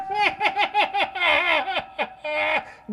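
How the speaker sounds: high, echoing Skeletor cackle; evil cackle. Acted. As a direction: cackle like a cartoon villain into an echo mic. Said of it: "famous for his Skeletor voice".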